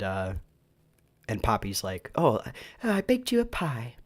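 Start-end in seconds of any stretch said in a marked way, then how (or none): 2.1-4.0 a lightly imitated British accent, higher. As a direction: mimic the character's British accent, pitched up. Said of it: "it's a British accent that they do for her".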